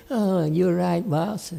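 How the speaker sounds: a hint of a cowed worker agreeing with his boss; mock meek apology. Master imitates the student meekly giving in to the boss. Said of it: "but he said".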